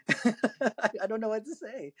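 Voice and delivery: high-pitched, words bubbling out through a laugh; helpless laughing. Real, not acted. Storyteller recalls beating the league champion, helpless laughing. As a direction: laugh at your own awkwardness as you speak.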